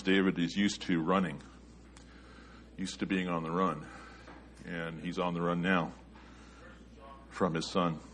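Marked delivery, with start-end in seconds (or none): none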